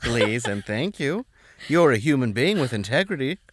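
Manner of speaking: high, effusive mock gratitude; exaggerated politeness. Customer buttering up drive-thru worker, hammed-up polite thanks. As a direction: flatter the server with over-the-top courtesy.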